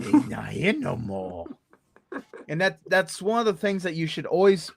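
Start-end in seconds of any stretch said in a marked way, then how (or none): 0.0-1.5 low, slow ominous voice; low spooky reply. Retold or imitated. The haunter answering the child ominously. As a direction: answer like something sinister. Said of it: "it's like".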